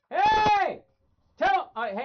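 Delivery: sudden high, loud shout; calling out. Real, not acted. Calling out at item spotted behind.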